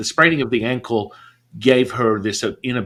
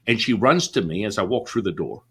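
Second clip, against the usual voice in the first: normal talking voice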